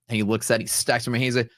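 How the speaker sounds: ordinary delivery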